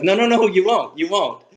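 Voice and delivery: high, eager no no no; excited protest. Retold or imitated. Teacher to embarrassed student, excited and high. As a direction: insist with delight. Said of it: "And I was like".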